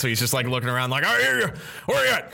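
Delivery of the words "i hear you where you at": blind blustering captain. Acted. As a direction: shout blindly at the unseen. GM voices blinded captain again.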